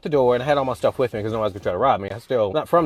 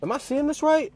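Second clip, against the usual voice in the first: stunned disbelief; 13 semitones higher than usual. Retold or imitated. Seeing the woman leave the bathroom, asking in high disbelief.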